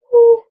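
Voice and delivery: big high whew out; relieved whew; loud whew. Real, not acted. Exhale after laughing.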